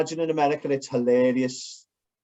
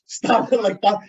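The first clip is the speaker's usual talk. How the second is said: laughing; 5 semitones higher, 4 dB louder than usual. Retold or imitated.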